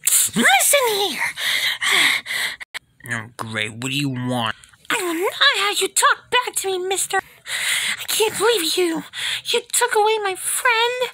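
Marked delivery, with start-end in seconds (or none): 0.1-1.2 scolding character. Acted. 4.9-11.1 high, indignant scolding voice; scolding high character. Acted.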